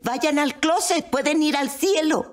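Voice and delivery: high, eager insistence; excited urging. Retold or imitated. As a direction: urge them on excitedly. Excitedly urging her screaming family.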